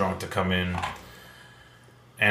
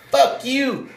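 high-pitched mock hostile outburst; imitated drunk insult; 12 semitones higher, much slower than usual. Retells hammered woman's insult.

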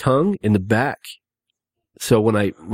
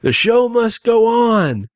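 mock dramatic; 12 semitones higher, 3 dB louder, slower than usual. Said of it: "he's like".

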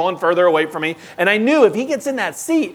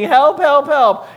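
raised, higher calls of help; mock cry for help; 8 semitones higher, 5 dB louder, slower than usual. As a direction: call out for help like a scared child. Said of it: "she was screaming".

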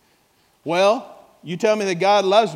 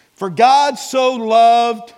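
"For God so loved" drawn out slowly, louder, higher; slow emphatic; 4 semitones higher, 6 dB louder, much slower than usual. Real, not acted. Preacher cueing the congregation, slow emphatic "For God so loved". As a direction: stretch each word of "For God so loved". Preacher slows for fill-in phrase.

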